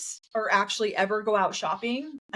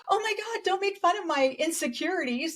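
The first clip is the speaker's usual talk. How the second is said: much higher, mock-offended whine; mock whiny Robin; 9 semitones higher than usual. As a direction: squeak it out like a hurt friend mocked for her figure. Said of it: "Robin's like".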